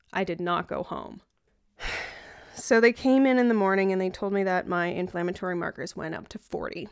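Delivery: ordinary delivery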